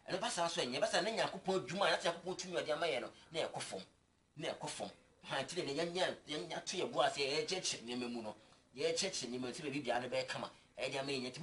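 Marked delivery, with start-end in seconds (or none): none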